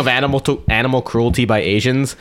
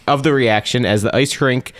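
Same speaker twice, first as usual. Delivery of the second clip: ordinary talk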